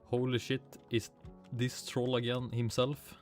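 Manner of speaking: normal talking voice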